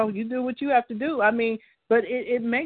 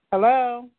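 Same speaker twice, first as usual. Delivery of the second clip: calling hello louder into the phone line; 3 semitones higher, 7 dB louder than usual. Real, not acted. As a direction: say hello again, louder, to check she's there. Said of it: "Let me try again".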